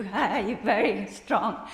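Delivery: loud, slow and emphatic; emphatic greeting. Pretend. Teacher demos an emphatic hello to her class, emphatic. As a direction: greet them with strong emphasis. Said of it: "very emph-, emphatic".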